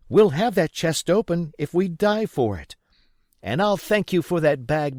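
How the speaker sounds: daring the men to shame them; defiant mother. Acted. Mother shames the cowardly villagers, high and loud defiance. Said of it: "my mother made them a speech".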